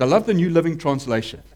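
ordinary talk, nothing marked

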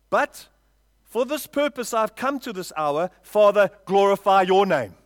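emphatic pulpit declaration, stretching the key lines; forceful scripture preaching. Acted. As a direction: preach the verse out to the congregation.

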